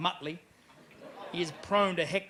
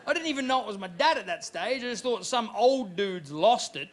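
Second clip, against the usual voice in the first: normal talking voice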